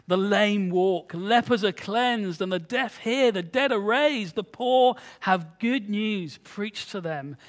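preacher reading Luke aloud; emphatic scripture reading. Acted. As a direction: proclaim the healings one by one. Reads scripture aloud to congregation.

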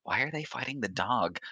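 whispered gossip from onlookers; crowd whispers. Acted. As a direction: murmur it under your breath like a curious bystander. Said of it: "Whispers start happening".

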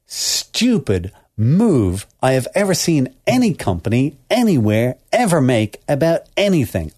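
slow, heavily punched outrage; exasperated rant. Real, not acted. Host rails at Apple's headphone move, exasperated and emphatic. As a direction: draw out each word in disbelief.

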